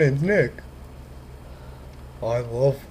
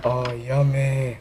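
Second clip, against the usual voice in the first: ordinary delivery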